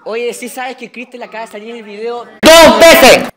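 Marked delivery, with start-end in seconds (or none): 2.2-3.3 sudden, very loud protest; yelled in heated game banter; loud outburst. Real, not acted. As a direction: blast it out at full volume. Gamers bickering, sudden very loud outburst.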